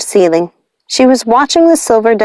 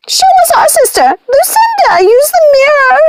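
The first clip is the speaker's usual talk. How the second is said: shouting. A narrator's reading. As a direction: urge Lucinda to the mirror. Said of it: "shouted Martha".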